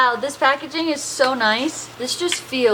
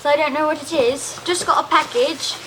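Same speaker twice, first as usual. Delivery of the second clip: put-on Australian accent; 3 semitones higher, slower than usual. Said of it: "I can't stop talking in an accent".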